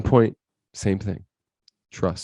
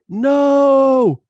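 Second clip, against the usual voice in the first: long high loud cry; crash scream; 9 dB louder than usual. Retold or imitated. Bystander as the crash happens, long loud scream. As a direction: wail it out, drawn-out and high.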